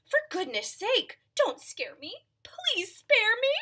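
high-pitched begging; mock-frightened plea; fearful pleading. Elephant begs mouse for mercy.

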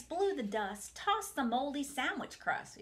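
plain reading voice

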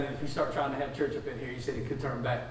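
hushed warning. Retold or imitated. Karaoke guy warning him off, hushed and low.

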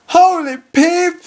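high, slow disbelief; stunned exclamation. Real, not acted. Reads national team mail.

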